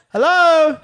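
loud, high call for attention; yelling out. Retold or imitated. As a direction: call out as if forgotten in a back room. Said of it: "I'm yelling out".

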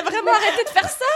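high, laughing scold; laughing mock scolding. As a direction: scold the crabs through laughter.

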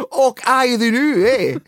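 high, loud exaggerated Scottish; gruff Scottish lord. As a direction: ham up the broad Scottish. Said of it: "saying all our favourite Scottish words".

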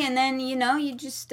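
ordinary delivery

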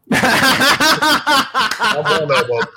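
drawn-out laugh through the words. Real, not acted.

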